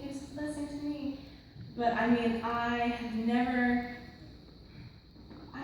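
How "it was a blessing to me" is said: softer, fast echo of the stock phrase, "blessing" leaned on; mocking repeat dropping to near-soft; repeated cliché, trailing off. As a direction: let the repeat fade away. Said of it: "you're always like".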